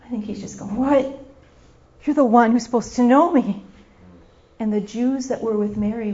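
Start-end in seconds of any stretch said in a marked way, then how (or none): 0.8-3.6 loud, incredulous reproach; hurt disbelief. Acted. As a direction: burst out as if let down by a close friend. Voicing Jesus hurt by Mary.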